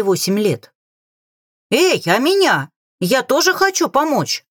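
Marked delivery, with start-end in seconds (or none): 1.7-4.5 high-pitched eager call; narrated shout from afar, held back in volume. A narrator's reading. King calling from the water to help, shouting. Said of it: "прокричал с воды его величество".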